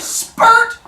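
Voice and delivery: high, loud explosive "spurt"; comic splatting exclamation; bursting sound effect. Retold or imitated. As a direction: make it pop like something squirting out. Imitating the pimple bursting.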